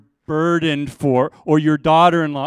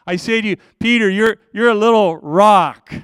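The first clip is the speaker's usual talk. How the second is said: higher and louder naming; emphatic declaration; 6 semitones higher, 6 dB louder than usual. Acted. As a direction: declare it with force. Preacher voices Jesus naming Peter.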